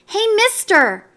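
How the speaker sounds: put-on young girl calling out; little girl calling. A narrator's reading. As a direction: tug his sleeve for attention. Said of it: "Marcella said".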